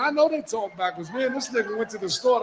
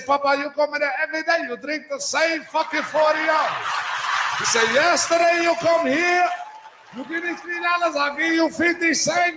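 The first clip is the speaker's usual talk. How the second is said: higher, rising to a shout, mock Spanish accent; store owner rant, Spanish accent; 8 semitones higher than usual. Store owner scolds regular customer over forty, mock Spanish accent.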